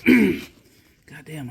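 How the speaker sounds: breathless, winded exclamation; huffing after illness; out of breath. Real, not acted. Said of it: "I'm still huffing and puffing".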